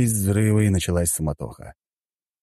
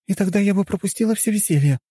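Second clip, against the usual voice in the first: high-pitched, sulky female voice; high, pouting retort; sulky young woman; 13 semitones higher than usual. A narrator's reading. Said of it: "насупилась девушка".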